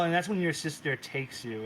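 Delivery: usual speaking voice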